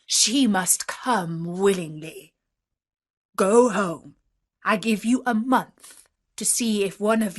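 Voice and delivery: stern monster orders, building to a loud threat; forceful, menacing beast voice; commanding beast. A narrator's reading. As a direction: lay down the law as the beast, ending in a roar.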